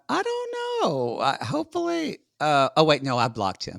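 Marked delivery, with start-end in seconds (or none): 0.1-2.2 very high, playful I don't know; coy high voice. Real, not acted. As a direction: dodge it with a squeak. Coy dodge about her ex.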